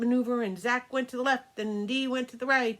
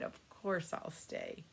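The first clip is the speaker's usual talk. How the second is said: lower, softer, slow reassurance; gentle promise; 6 semitones lower, 12 dB quieter, slower than usual. Acted. Pegasus promising Tia to stay for her birthday, low soft gentle voice.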